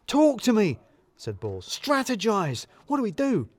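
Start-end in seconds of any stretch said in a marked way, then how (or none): 0.1-0.8 high, pushy demand for answers; urgent boss. Acted. Balls demanding a plan. 1.7-3.5 high, pushy demand for answers; urgent boss. Acted. Balls demanding a plan.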